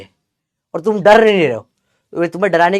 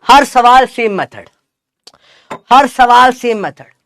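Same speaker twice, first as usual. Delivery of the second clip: loud, high, slow drilling call; raised-voice classroom refrain; emphatic slogan; 6 semitones higher, 6 dB louder, slower than usual. Real, not acted. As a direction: hammer the slogan home, loud and drawn-out. Drills his slogan, loudly repeated.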